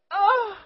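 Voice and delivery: high, loud yelp of pain; re-enacted pain cry. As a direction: cry out as if jabbed by a needle. Acts out pain of first shot.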